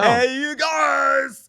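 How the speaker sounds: slow, drawn-out loud high shout; hammed-up stretched greeting yell; mock greeting shout. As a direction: call out like a friend from across a room. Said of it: "He says".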